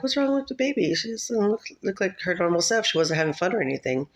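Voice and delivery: lightly acted worried parents puzzling aloud; retold parental worry. Retold worried parents discussing baby.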